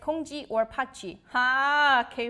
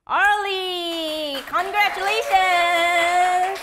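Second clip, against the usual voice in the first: loud, drawn-out congratulations; announcing a winner; 5 semitones higher, 9 dB louder, much slower than usual. Real, not acted. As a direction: call the winner out big and slow. Announcing a winner's name loudly.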